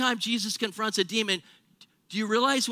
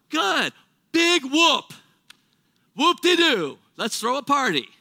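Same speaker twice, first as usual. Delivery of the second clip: high, loud mocking whoop-de-doo; sarcastic paraphrase of the verse; sarcastic mockery; 5 semitones higher, 5 dB louder, slower than usual. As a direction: throw it off with scornful glee. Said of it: "That's what he means by that".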